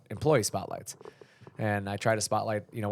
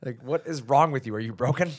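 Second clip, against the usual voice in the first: imitating a baffled, scolding dad, a little raised; hammed-up parental disbelief; exasperated dad; 4 semitones higher, 4 dB louder than usual. Dad to his screaming kids, mock exasperated. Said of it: "Dad says".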